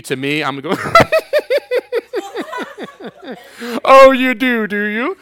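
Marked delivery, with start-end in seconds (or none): 1.0-3.3 very high-pitched, put-on giggling laugh; hammed-up squeal of laughter; mock laugh. Father reacting to his son's "I hate you", high mock laughter. 3.8-5.2 high, loud, put-on teasing; mock taunting. Father answering his son's "I hate you", mock taunting.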